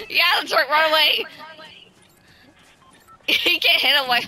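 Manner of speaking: usual speaking voice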